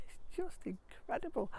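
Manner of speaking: high, squealing wonder; thrilled. Real, not acted. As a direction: gush with wonder. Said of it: "I'm so, so excited".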